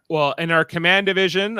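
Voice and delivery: ordinary delivery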